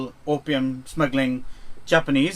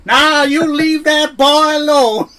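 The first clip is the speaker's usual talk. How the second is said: higher, louder, slow Texas drawl; imagined tough Texan; 11 semitones higher, 9 dB louder, slower than usual. Pretend. Imagining the actor defending his brother, in a loud mock Texas drawl. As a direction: lay on the Texas drawl, warning them off.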